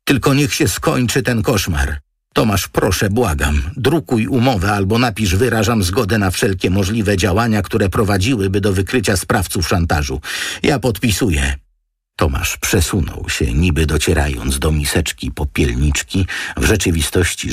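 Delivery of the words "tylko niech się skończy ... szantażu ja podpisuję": higher, pleading woman's voice; begging him to take the case; Antonina, pleading. A narrator's reading.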